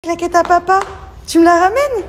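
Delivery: voix très haute et plus forte; high dog-calling voice. Real, not acted. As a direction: appelle le chien d'une voix aiguë. Appelle le chien pour la claquette. (voix aiguë pour appeler le chien)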